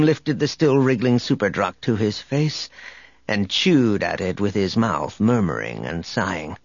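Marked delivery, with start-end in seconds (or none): none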